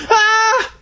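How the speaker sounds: high, short startled shriek; jumpy high yelp of fright; startled scream. Acted. Boy tapped on the shoulder, startled scream.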